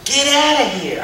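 put-on high, excited kid exclaiming; a kid who can't believe his luck; amazed kid. Kid gets the big toy, high amazed exclaim.

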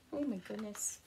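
ordinary delivery, nothing marked

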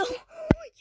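sudden high startled gasp. Real, not acted. Gamer in a tense moment, startled gasp. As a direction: gasp at the near miss.

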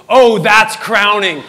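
loud, drawn-out shocked exclamation; alarmed realization. Retold or imitated. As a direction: blurt it out in comic horror. Retelling shock at seeing crowning.

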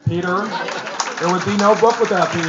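loud call to someone in the audience; loud, projected tribute. Real, not acted. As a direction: raise your voice to publicly credit him. Calls out to agent in audience.

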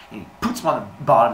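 usual speaking voice

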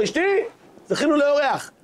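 high-pitched happy call to his wife; joyful welcome. Retold or imitated. Rich host tells his wife a guest has come, joyful. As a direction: call out the good news gladly. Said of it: "בשמחה ובטוב לבב".

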